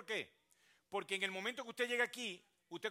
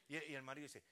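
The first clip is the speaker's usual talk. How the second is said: usual speaking voice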